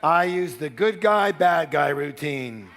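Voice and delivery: loud, slow proclamation; declaration. Shown as a demonstration. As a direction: declare it to the room. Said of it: "Please stand up for the declaration".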